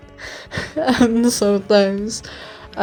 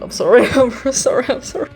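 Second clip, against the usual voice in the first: laughing; 7 semitones higher, 5 dB louder, much faster than usual. Real, not acted. Embarrassed apology while laughing.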